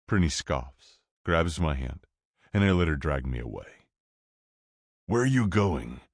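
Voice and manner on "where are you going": ordinary delivery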